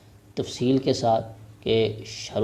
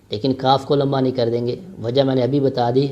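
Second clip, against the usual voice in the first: ordinary delivery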